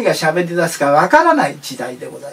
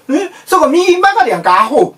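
Osaka dialect; 8 semitones higher, 3 dB louder than usual. Retold or imitated. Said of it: "大阪弁で喋るカーナビ".

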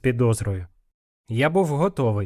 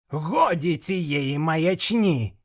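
slow, high indignant cry; exasperated outburst; 5 semitones higher, much slower than usual. A narrator's reading. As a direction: burst out, stretching each word in indignation. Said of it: "вибухнув Квіглі". (протяжний обурений вибух)

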